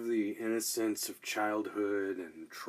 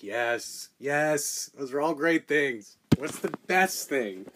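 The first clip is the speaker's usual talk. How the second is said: rising, louder and louder; building to loud; 4 semitones higher, 8 dB louder than usual. Real, not acted. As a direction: build it up to a shout. Host builds to shout.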